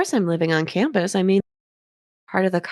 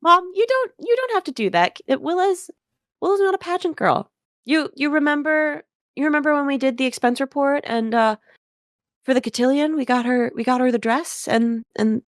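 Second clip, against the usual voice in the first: higher, pleading embarrassed son; a flustered young man talking his mom down; 8 semitones higher than usual. Acted. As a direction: beg mom to stop, flustered. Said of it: "He goes".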